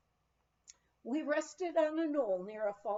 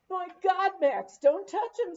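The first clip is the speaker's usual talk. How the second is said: higher and louder, sudden alarmed cry; alarmed warning; 8 semitones higher, 10 dB louder than usual. Acted. Old miner warning Max off frozen dynamite, alarmed shout. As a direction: shout a panicked warning to stop him.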